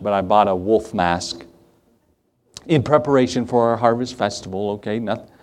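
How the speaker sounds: ordinary talk, nothing marked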